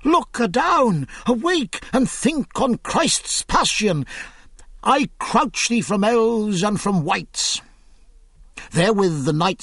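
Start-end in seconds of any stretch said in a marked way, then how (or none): 0.0-7.6 higher, urgent shouts to wake him; shaking a friend who won't answer, mildly hammed-up; calling out in alarm; frantic crying out. A narrator's reading. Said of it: "cried spittously".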